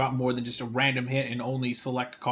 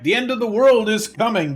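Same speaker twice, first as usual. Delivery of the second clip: loud, raised doomsday preacher voice; mock cult leader proclamation; 6 semitones higher, 6 dB louder than usual. Mocking the game's cult leader, a loud proclamation. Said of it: "a cult leader who's like".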